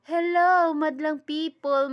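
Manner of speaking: loud, high TV host hello to the crowd; hammed-up host greeting to the audience; showbiz host greeting. Shown as a demonstration. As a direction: belt it out like a variety show host. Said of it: "Say hi to madlang people".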